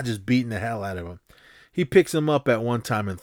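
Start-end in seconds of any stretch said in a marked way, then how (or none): none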